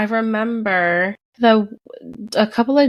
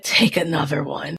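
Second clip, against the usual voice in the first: low-effort whisper, imitating a sleeper; whispered reply; 5 semitones lower than usual. Partner woken from dead sleep answers her, whispered. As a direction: whisper it without any voice.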